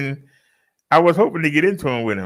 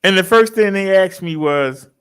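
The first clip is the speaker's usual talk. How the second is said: ordinary talk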